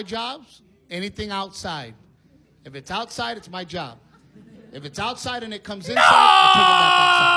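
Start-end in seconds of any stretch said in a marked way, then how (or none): 6.0-7.4 loud, high shouted punchline. Real, not acted. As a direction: bellow it out. Punchline shouted, huge jump.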